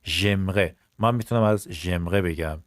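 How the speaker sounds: ordinary delivery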